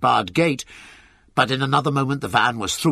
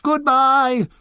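high, loud goodbye; chorused farewell call; 13 semitones higher, 5 dB louder than usual. A narrator's reading. Animals crowded round the van, chorused farewell shout. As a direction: sing out a farewell across the yard. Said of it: "they chorused".

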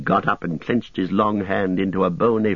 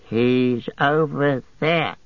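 higher and slow; cackling; 6 semitones higher, much slower than usual. A narrator's reading. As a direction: Gollum sly and gloating. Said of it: "he cackled".

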